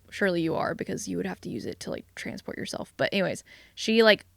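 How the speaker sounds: ordinary delivery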